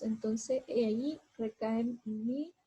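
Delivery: usual speaking voice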